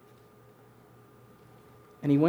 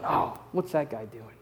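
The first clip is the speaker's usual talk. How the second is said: muttering critic; 6 semitones lower, 9 dB quieter, slower than usual. Retold or imitated. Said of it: "you've got people around going".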